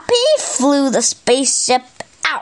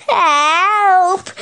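higher, drawn-out cry for help; storybook shout for help; 5 semitones higher than usual. A narrator's reading. Zog among the fireballs calls for help, high drawn-out cry. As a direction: call out in mock alarm. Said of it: "shouted Zog".

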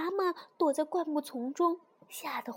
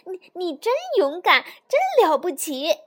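excited cry; 3 semitones higher, 7 dB louder than usual. A narrator's reading. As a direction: gush with admiration. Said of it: "他叫道".

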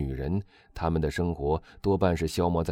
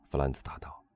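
ordinary delivery

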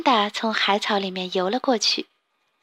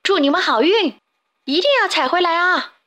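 a high, louder shouted send-off; shouting farewell; 7 semitones higher, 3 dB louder than usual. Acted. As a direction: call after them as they swim off. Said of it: "他们喊道".

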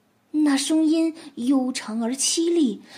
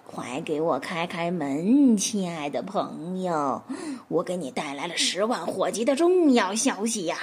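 low pitiful coaxing; 5 semitones lower than usual. Acted. Wolf at the fox's door, lowered voice, pitiful and sweet. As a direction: coax the door open with a pitiful voice. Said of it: "尽量压低嗓子，用一种让人听了觉得既可亲又可怜的声音说".

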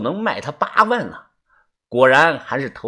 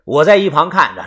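ordinary delivery

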